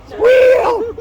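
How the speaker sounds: very high loud squeal; loud piercing pig squeal. Acted. Pig noise in song.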